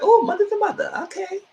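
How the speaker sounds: very high squealing delight; gleeful squeal. Retold or imitated. Viewer squealing as Tasha meets Monet. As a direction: squeal with excitement. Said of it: "I said".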